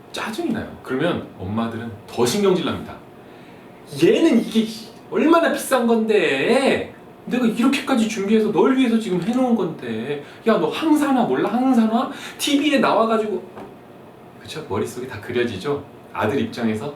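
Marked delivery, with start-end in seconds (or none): 4.0-13.4 scolding mom. Acted. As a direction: nag him like an offended mom. Mom scolding son in skit.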